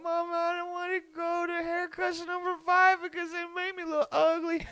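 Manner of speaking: high, whiny mock-crying kid voice; crying child. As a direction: wail it like a tearful kid pleading with mom. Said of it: "I would cry so fucking hard".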